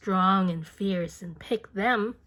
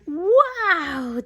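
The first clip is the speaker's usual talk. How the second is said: high, loud, drawn-out wow; loud, high exclamation of surprise; surprised wow; 9 semitones higher, 8 dB louder than usual. Acted. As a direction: react as if you felt the sting. Bee stings Ferdinand.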